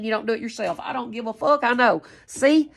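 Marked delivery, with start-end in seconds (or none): none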